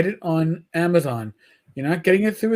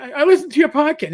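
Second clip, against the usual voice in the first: high, eager fan imitation; retold starstruck voice; excited fan; 8 semitones higher, faster than usual. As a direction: mimic a gushing listener, pitched up. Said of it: "all of a sudden I hear".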